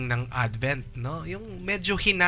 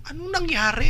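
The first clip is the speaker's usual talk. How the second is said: much higher, startled asking; shocked question; 13 semitones higher than usual. Retold or imitated. Host mimicking a bewildered listener asking what happened, shocked. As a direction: gasp out the question in alarm. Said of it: "Yung tanong".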